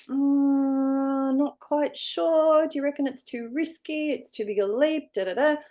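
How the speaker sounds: high, slow, hesitant worrier; doubting shoulder voice. Retold or imitated. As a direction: drag it out, unsure and wary. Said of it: "the voice on this shoulder's going".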